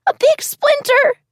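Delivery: put-on very high little child's voice; a frightened small child complaining; high-pitched child. A hurt child telling Jessa about the splinter, high and scared. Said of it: "a high-pitched voice repeated".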